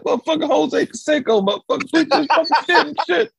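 high, loud mock cursing rant; imitated rant. Retelling a friend going off, high loud mock rant.

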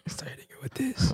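breathy whisper, just woken up; sleepy whisper. Shown as a demonstration. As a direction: whisper it like you're half asleep. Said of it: "our sleepy voice".

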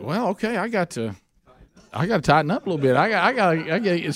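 high and fast, scrambling thoughts; mild panic. Retold or imitated. Speaker to himself before the big sermon, mild panic. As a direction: hurry yourself to get it together.

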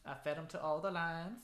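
soft, sugary TV-host greeting; Carole Baskin voice. Retold or imitated. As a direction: purr it like a sweet cat lady. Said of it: "Carole Baskin".

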